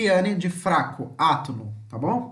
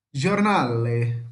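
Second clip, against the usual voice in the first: slow "giornale", stretched on the NA; Italian "giornale", jor-NAA-le, sinking lower; penultimate stress; 5 semitones lower, much slower than usual. Shown as a demonstration. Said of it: "possuem a penúltima sílaba tônica". ("giornale" devagar, com a penúltima sílaba forte)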